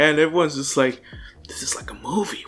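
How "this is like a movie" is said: whispered aside in the crowd; hushed amazement. Retold or imitated. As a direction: whisper it like a stunned onlooker. Stunned spectators murmuring in retelling.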